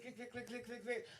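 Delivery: soft clicking heels sound; imitating heel clicks.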